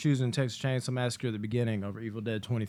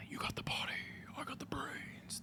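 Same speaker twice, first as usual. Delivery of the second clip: breathy whisper of a villain's line; whispered movie quote; 17 dB quieter than usual. Retold or imitated. Fan whispering a favourite movie line, hushed. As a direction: whisper it like a sly secret.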